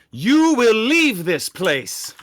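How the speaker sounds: loud, high, drawn-out proclamation; booming command. Acted. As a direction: order them out, slowly and loudly. Player voices character's command.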